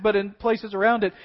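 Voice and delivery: ordinary talk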